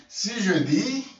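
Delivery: ordinary delivery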